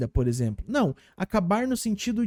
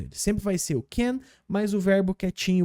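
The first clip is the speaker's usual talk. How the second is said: ordinary talk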